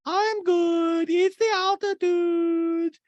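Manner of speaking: high, slow, stretched caricature; Diana. Retold or imitated. Podcaster mimicking Diana brushing off help, hammed-up high voice. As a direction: draw it out in a squeaky mock voice.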